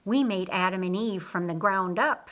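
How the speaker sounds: ordinary delivery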